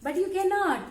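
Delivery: high, excited ostrich voice; high-pitched boastful bird; boasting ostrich. Acted. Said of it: "ostrich came".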